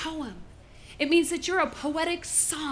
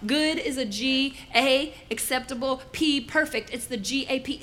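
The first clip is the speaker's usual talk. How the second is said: usual speaking voice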